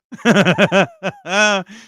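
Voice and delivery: loud, helpless run of laughter; real belly laugh. Real, not acted. Host saying he'd watch that absurd film, laughing loudly. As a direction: keep cracking up.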